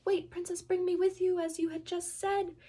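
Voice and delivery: high, loud call after someone running off; calling after her. Acted. Frog calling after the princess as she runs, high and loud. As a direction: shout after the fleeing princess. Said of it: "calls after her".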